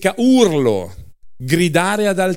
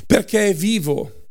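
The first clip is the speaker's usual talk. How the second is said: normal talking voice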